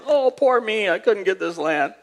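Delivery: hammed-up poor-me moan; mock crying. King crying to his wife over land, mock whining. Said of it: "crying to his wife".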